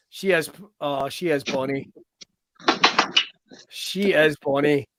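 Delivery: soft, slow, tender admiration; cooing at the dog. Real, not acted. Host admiring the guest's dog, soft slow coo. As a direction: melt over the dog, quietly and slowly.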